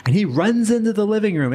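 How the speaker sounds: usual speaking voice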